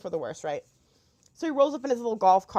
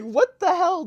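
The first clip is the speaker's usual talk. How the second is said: shocked outburst; 10 semitones higher, 9 dB louder, slower than usual. Retold or imitated. Retelling her shock at the rude desk clerk, laughing and loud. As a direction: laugh breaking into a high, loud "what the hell".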